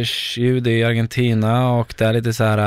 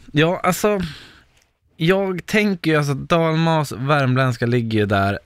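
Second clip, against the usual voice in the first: ordinary talk, nothing marked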